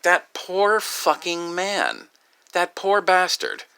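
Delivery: high, drawn-out pity; upset, pitying. Retold or imitated. Man watching his own video, retelling his upset pity. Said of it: "I'm getting upset".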